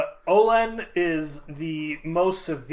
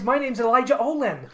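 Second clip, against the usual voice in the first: higher, chirpy self-introduction impression; Eli Olin; 6 semitones higher than usual. Shown as a demonstration. Castmate mimicking Eli introducing himself, higher put-on voice. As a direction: introduce yourself as a mock-friendly character. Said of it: "we're doing it, Eli".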